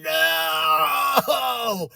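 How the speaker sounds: high, drawn-out crying "no"; re-enacted anguished cry; bawling wail. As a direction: wail it out, acting out a bawl. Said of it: "bawling my head out".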